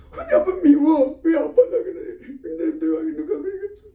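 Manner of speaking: put-on high, slow tearful child whine; whiny resentful child. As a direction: play a child crying at daddy. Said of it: "미웠다고 막 그렇게 한 거예요". (울면서 투정 부리는 아이 목소리)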